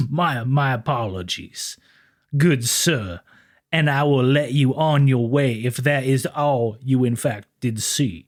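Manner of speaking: smooth, courteous lawman voice; a gentlemanly officer letting him go; mock Southern gentleman cop, smooth; smooth polite officer. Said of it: "You're good with that smooth voice here".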